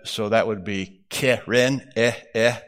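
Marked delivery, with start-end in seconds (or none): 1.1-2.6 keren split as keh-ren, then each eh alone; sounding out vowels. Shown as a demonstration. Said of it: "has two of what are called the sagol".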